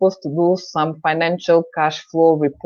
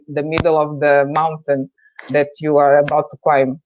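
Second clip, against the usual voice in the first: ordinary talk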